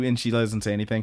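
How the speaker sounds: normal talking voice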